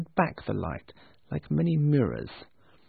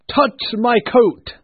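high, loud, slow spirit voice; booming ghost voice; 8 semitones higher, 8 dB louder, slower than usual. A narrator's reading. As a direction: command grandly like a giant ghost. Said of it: "said the ghost".

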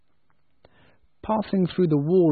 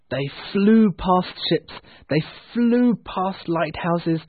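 soaring wonder; 6 semitones higher, 5 dB louder, slower than usual. A narrator's reading. Storyteller's soaring flight.

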